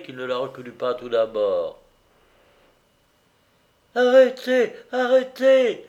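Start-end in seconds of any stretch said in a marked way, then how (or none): 4.0-5.8 "arrêtez" moaned high and louder, winded from running; a wife gasping after running across the fields; breathless moan. Acted. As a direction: plead "Arrêtez" out of breath, arms outstretched. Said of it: "gémit-elle encore, à bout d'haleine".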